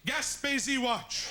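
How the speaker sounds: slow, high title announcement; mock title. Shown as a demonstration. Pitching the Quebec Baywatch title, slow. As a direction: announce "Gaspésie Watch" slowly.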